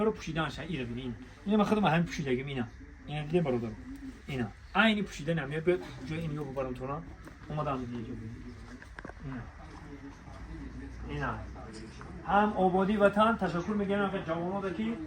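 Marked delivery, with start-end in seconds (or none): none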